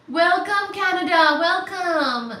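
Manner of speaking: loud, high greeting; grand welcome. Real, not acted. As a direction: call out a big welcome.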